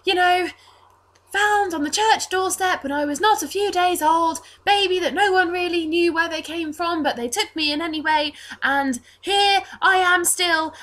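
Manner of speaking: put-on high, breezy boy reciting his well-worn tale; Reese. Orphan boy telling his familiar origin story, high and breezy. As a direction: rattle on as a child, ending in a loud burst of wonder. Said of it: "as if he's telling a story that he thinks".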